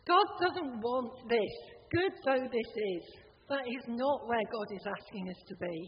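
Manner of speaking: ordinary talk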